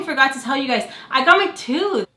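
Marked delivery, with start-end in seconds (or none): 1.1-2.1 excited announcement. Real, not acted. Vlogger telling viewers the big news, excited. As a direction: blurt it out, high and excited.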